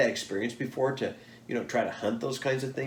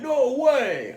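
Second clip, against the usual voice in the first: much higher and louder, incredulous; disbelieving; 6 dB louder than usual. Retold or imitated. The weigher won't believe the catch, high incredulous. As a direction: blurt it out in total disbelief.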